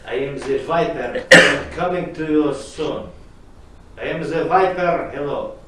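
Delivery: loud, slow ominous growl of a phone menace; menacing caller. Acted. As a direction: boom the villain's threat. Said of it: "the caller repeated".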